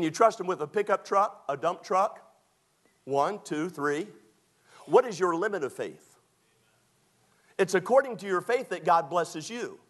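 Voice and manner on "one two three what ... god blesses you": ordinary talk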